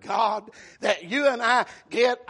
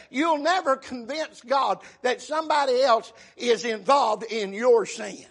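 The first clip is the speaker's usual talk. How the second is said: ordinary delivery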